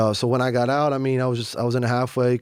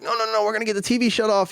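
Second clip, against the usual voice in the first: high, fast scared voice; mock frightened plea, high and quick; scared inmates; 10 semitones higher, faster than usual. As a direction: mimic panicked grown men, pitched up high. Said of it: "They're like".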